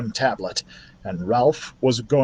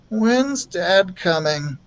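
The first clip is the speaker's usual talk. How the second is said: put-on sick child; 6 semitones higher, much slower than usual. A narrator's reading. Feverish boy asking his mother about dad, weak and slow. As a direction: ask weakly like a feverish boy.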